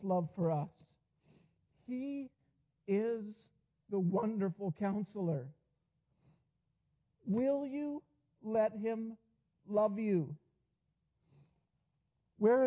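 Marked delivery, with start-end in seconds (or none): 1.9-5.5 rising, higher-pitched proclamation, word by word; preaching. Acted. Preacher building to climax. 7.2-10.4 much higher and louder, pleading question; preaching appeal. Acted.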